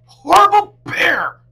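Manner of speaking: very high loud furious shout; angry yell. A narrator's reading. Girl arriving home, yelling angrily at Bear. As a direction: scream the name all the way home.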